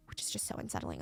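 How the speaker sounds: hushed creeped-out whisper; a breathless unsettled whisper. Real, not acted. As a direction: whisper it like something chilling.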